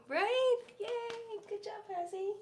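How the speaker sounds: high, loud cheer for the dog; excited cheer. Real, not acted. Cheering her dog for eating the treat, excited squeal. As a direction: squeal with delight.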